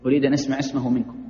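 ordinary talk

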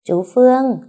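put-on a sick boy brightening at seeing his uncle; soft cheer. A narrator's reading. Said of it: "reo lên khe khẽ".

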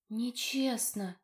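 faint, slow sleepy whisper; weak whisper. Acted. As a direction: barely breathe the word out in sleep. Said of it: "раздался слабый шёпот спящей девушки". (слабый шёпот во сне)

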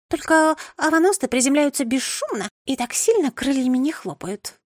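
high knowing bird voice; instructive pigeon voice. Acted. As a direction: explain like a fussy expert. Second pigeon lectures the girl. (поучительный птичий голос)

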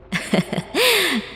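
a high, gleeful laugh; delighted laugh. Acted. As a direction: laugh with delight at the new whip. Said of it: "cảm ơn rối rít". (tiếng cười thích thú, cao giọng)

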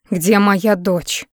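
ordinary delivery, nothing marked

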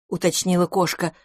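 usual speaking voice

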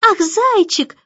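high-pitched adoring exclamation; echoing delight. A narrator's reading. Little girl tugging the baby's blanket, echoing delight. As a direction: gush like a small child. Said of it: "вторила Барбара".

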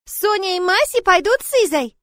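put-on a toddler announcing her plan; confident little girl. Little Sonya insists on going with Liza, high childish voice. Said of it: "заявила это весьма уверенно".

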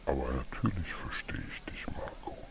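low, soft, rumbling elephant's whisper; old elephant answering in a dream, deep and soft. A narrator's reading. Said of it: "mit einer ganz tiefen Stimme".

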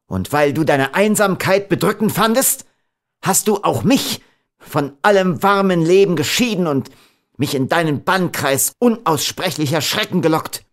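high, forceful accusation; wicked anger. A narrator's reading. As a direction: accuse her in rising wrath. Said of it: "schrie er in bösem Zorn".

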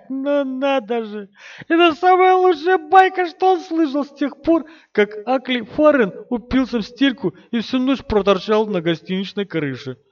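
high, loud laughing voice; laughing farmer. Acted. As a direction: laugh through the words.

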